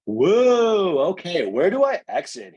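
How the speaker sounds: loud, high, drawn-out whoa; hammed-up leaving the stage; mock flustered exit. Acts out Lisa after her audition.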